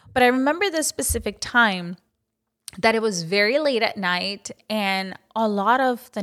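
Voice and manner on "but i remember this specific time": usual speaking voice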